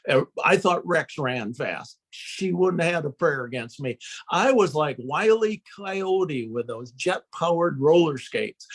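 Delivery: ordinary delivery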